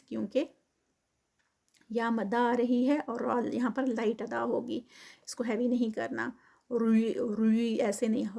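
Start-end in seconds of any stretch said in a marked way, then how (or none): none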